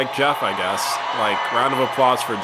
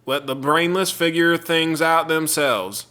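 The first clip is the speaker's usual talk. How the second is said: lightly hammed Southern accent, louder on the insults; 3 dB louder than usual. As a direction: keep up the mock Southern commenter voice. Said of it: "I'm gonna be reading it in a southern accent".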